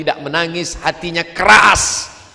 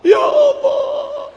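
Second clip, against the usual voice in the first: very high, slow wail; stretched tearful cry; imitated sobbing prayer; 4 dB louder, much slower than usual. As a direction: wail it out as a weeping old pilgrim. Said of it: "Bapak tuh sedang menangis".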